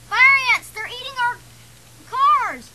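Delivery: high, loud panicked warning; alarmed yelling. Acted. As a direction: yell out the danger to everyone. Alarm at ants attacking the cars.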